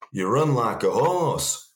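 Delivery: lightly imitated teammate accent; imitating Chippy's accent. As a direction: put on Chippy's accent, drawn out. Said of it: "That's Chippy's accent".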